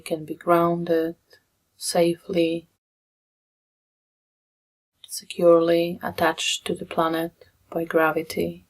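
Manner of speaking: soft meditation guidance with long pauses; guided meditation. Real, not acted. Meditation leader guiding listeners into breath and body, softly and unhurried. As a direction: ease them gently through it, leaving long silences.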